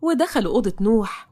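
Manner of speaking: usual speaking voice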